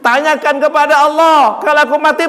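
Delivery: high, loud, slow cry; anguished plea. Acted. Preacher voicing the Prophet asking for his people, high and slow. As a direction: plead for the people.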